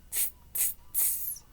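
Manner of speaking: unvoiced tss sounds; monkey tss hiss. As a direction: hiss back like cheeky monkeys. Monkeys' reply.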